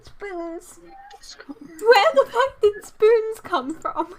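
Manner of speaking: startled high-pitched squeal. Real, not acted. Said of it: "Why am I squealing now?".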